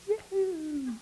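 high, whooping yahoo; a jubilant yee-haw; mock cowboy whoop. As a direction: let out a gleeful cowboy yell. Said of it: "you'd be going".

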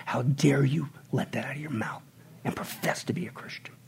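hushed stern whisper; whispered rebuke. Real, not acted. Preacher rebuking flippant Christians in a whisper. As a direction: scold them under the breath.

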